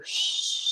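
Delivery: soft imitated shh of creeping; quiet sneaking sound effect; hushing rustle. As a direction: make the grass whisper. Imitates silent creeping through grass.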